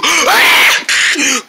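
high-pitched scream; frightened scream. Acted. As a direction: scream as the monster comes. Monster comes to get him.

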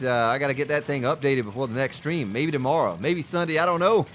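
normal talking voice

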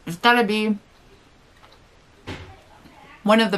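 talking with food in mouth; muffled chewy speech; mouth full chewing. Real, not acted. Seen 1: bite at -4, cheeks full and chewing through early words.

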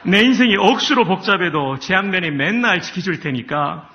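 hearty Gyeongsang countryman reciting scripture; lively Gyeongsang accent with a chuckle; Gyeongsang dialect. Shown as a demonstration. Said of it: "한번 경상도".

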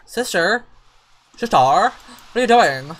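high, loud calling-out; scolding shout. Real, not acted. Player calls out a fighting Sim, scolding loudly. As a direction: shout at her to stop.

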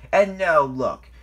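loud, slow, heated blame; high, loud furious complaint; angry growl. A narrator's reading. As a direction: rage at the culprit, dragging the words. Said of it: "growled Airy".

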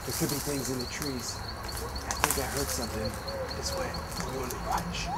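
a hushed voice, like stalking an animal in the dark. Acted. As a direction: creep along keeping it very soft. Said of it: "I need to be very quiet".

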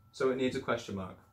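normal talking voice

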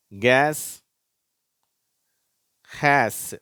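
கேஸ், ஹேஸ் with an open "ae" vowel, loud and separate; pronunciation demo. Shown as a demonstration. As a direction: model கேஸ், ஹேஸ் with the "a" of English "cat". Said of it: "ஆ என்ற உச்சரிப்போட உச்சரிக்கப்படுகிறது".

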